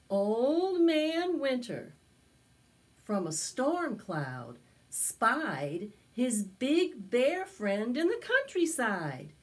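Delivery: put-on slow, lively read-aloud for children; storybook narration. A narrator's reading. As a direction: tell it to a circle of little kids.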